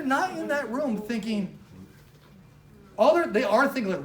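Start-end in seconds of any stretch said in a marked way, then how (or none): none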